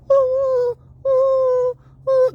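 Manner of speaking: high, hooting dove call; long, high held oohs; mourning dove coo. Retold or imitated. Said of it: "The morning dove kicked in".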